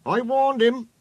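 high, loud and cross. A narrator's reading. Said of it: "said Rusty crossly".

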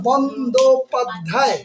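"Bandyopadhyay" slowly, each syllable lengthened; drawn-out syllables. Shown as a demonstration. Teacher introducing a word to count, "Bandyopadhyay" drawn out by syllable. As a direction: stretch "Bandyopadhyay" beat by beat. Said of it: "কয়টা আছে".

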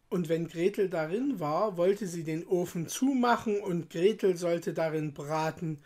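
plain reading voice

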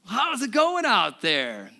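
a mild mock call across the water. Jesus calls again from the shore, a mild mock yell. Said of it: "yells out to Peter".

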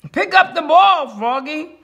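high-pitched urgent order; coach's yell. A narrator's reading. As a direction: yell instructions across the field. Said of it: "yelled the coach".

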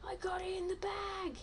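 hushed and breathless; whispering. Real, not acted. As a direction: whisper it so the seeker can't hear. Gaming, hiding, unvoiced line.

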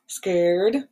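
gasp, then say it scared and hushed; play-acting fright for little kids; scared face. Shown as a demonstration. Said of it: "What's your scared face?".

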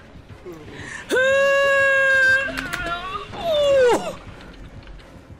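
high drawn-out wail; long anguished scream. Real, not acted. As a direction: cry out as you get hooked. Long scream when caught.

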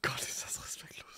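fassungslos flüstern; whispering. Real, not acted. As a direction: tonlos gehauchtes Staunen. Lacht sich kaputt. (flüsternd)

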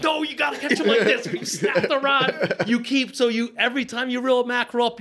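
high, worked-up mock instruction with a laugh; frantic coaching. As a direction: pitch it up like an exasperated demonstrator. Acting out frantic coaching of crew.

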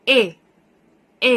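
short, isolated "เอ" vowel; short e sound. Shown as a demonstration. Teacher shows children the short vowel, short e sound. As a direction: say "เอ" as a short e, cut off. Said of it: "มีเสียงว่า".

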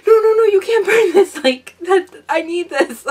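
high, loud pleading objection; urgent protest. Retold or imitated. Daughter to mom about burning the shelf, urgent protest. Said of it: "I'm like".

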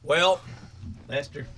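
low, hushed campfire-tale voice; spooky storyteller. Acted. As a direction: drop it low like a ghost story. Spooky storyteller opening, lowered voice.

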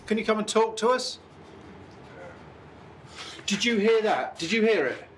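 calling out. Real, not acted. Investigator asks spirit to come and talk, calling out. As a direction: project it to someone unseen.

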